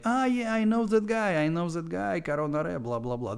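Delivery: high, mock-excited recognition; excited fan impression. Everybody recognizing the streamer by his accent, mock-excited. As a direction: imitate a thrilled fan, pitch jumping up then easing. Acting out fans recognizing him.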